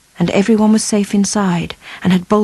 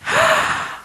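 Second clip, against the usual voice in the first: excited gasp; 4 dB quieter than usual. Acted. Sam hears of bigger reward.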